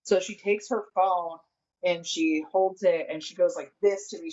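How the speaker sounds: usual speaking voice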